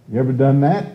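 ordinary talk, nothing marked

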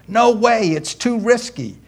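hammed-up nervous girl saying no; high girl's voice. Girl refusing her date at the door, high mock pleading.

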